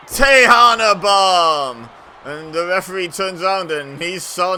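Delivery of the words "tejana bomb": loud, high, slow finisher call; shouted call. Real, not acted. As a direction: draw out the move name and belt it.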